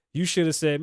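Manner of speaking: ordinary delivery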